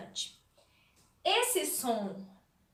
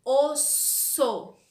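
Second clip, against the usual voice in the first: "osso" said louder, the S pressed hard; emphatic demo word; 5 dB louder than usual. Shown as a demonstration. Teacher to kids, loudly stressing "osso". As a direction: punch "osso" to show the S. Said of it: "o som do S é esse".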